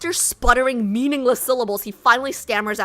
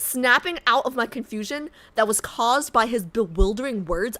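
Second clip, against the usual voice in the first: ordinary delivery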